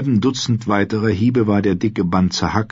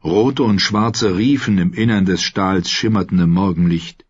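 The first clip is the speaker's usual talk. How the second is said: usual speaking voice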